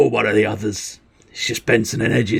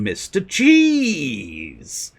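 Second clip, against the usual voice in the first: excited greeting; 5 semitones higher, much slower than usual. Real, not acted. Streamer greeting a viewer by name.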